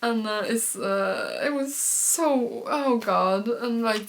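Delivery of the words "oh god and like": ordinary talk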